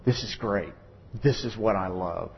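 low, soft, sly devil gloating; gloating Satan. Retold or imitated. Preacher voicing Satan gloating over a family quarrel, low and sly. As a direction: savor it like a villain rubbing his hands. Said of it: "rubbing his hands going".

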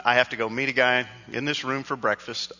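ordinary talk

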